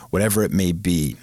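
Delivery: ordinary talk, nothing marked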